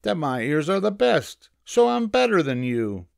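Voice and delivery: high-pitched triumphant rabbit; cocky little rabbit voice; bragging rabbit. A narrator's reading. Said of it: "bragged the rabbit".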